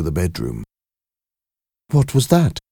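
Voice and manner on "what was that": higher, alarmed question; startled question. Acted. Wife hears a knock in the night, alarmed. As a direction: ask it startled, voice up. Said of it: "asked Mary".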